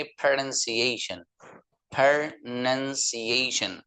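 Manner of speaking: slow प्रोनंसिएशन with "nun" sound; correct pronunciation. Shown as a demonstration. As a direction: repeat प्रोनंसिएशन slowly for students. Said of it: "इसलिए आप इसको पढ़ेंगे". (धीमा सही उच्चारण प्रोनंसिएशन)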